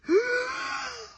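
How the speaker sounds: big gasp before blowing. Retold or imitated. Demonstrating how she tackled the grease fire, big acted gasp. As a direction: suck in air hard to blow out flames. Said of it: "What if I blow on it?".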